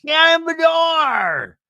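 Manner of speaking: high drawn-out yelled name; hammed-up screaming of a full name; imitating a scream. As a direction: stretch the name out in a mock scream. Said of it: "Emily's just screaming".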